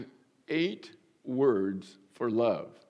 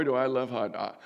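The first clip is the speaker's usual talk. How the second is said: usual speaking voice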